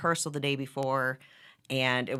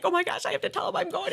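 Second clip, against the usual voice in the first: flustered; 14 semitones higher, faster than usual. Retold or imitated. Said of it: "and I'm just like".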